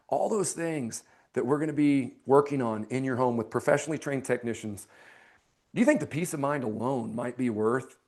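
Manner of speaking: low, soft close with falling lines. Shown as a demonstration. Salesman asking homeowner the closing question, soft down tone. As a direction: drop the voice and ask it gently. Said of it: "I'm always gonna do that on a down tone".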